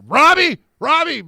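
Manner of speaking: high, loud mock rant of an old man; rambling yell. Telling a story, imitating the owner yelling on the call. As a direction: bellow the name, way up high. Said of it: "he's like".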